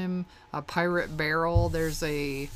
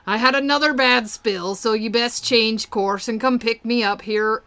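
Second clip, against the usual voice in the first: high, loud pirate hail; pirate captain voice; 4 semitones higher, 7 dB louder than usual. Acted. Stranded captain asking to be picked up, loud raised pirate voice. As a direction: call to the crew in a hammy pirate accent. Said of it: "And then he says".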